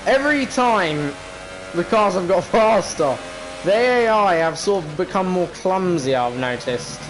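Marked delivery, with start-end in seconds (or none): none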